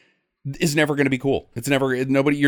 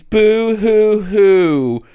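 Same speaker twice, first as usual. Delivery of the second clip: fake crying; 6 semitones higher, 7 dB louder, much slower than usual. Mocking hippie movie, fake sobbing.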